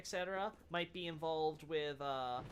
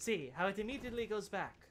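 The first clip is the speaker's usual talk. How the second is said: nature documentary; 3 dB quieter than usual. Acted. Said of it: "a Mimic in its natural habitat".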